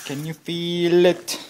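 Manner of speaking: high, loud taunting yell. Shown as a demonstration. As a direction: shout the question in triumph. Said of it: "repeated louder".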